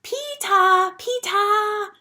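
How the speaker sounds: put-on very high little-kid call, "Peeta" with no final R; toddler calling out. A narrator's reading. Little brother up on the jungle gym, toddler calling out. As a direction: call "Peeta" like a tiny child, last vowel an open "ah". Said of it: "That's how he says my name".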